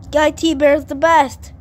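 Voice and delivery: shouting the magic words. Acted. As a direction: yell it like a magic spell.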